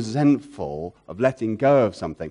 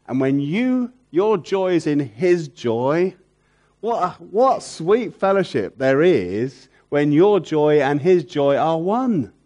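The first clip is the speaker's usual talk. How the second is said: slow, louder, higher, swelling fervour; preacher lost in the joy of fellowship; impassioned preaching; 5 semitones higher, 6 dB louder, slower than usual. Acted. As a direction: preach it, lingering on every word. Preaching at full force.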